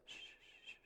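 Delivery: put-on whispered shushing to a baby; mother hushing her crying baby, whispered; hushing shh. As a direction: soothe a crying infant with a soft shh.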